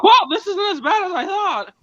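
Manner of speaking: high, slow, put-on amazed voice; slow, high mock wonder; mock surprised voice. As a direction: squeak it out like a pleasantly surprised kid. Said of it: "I'm like".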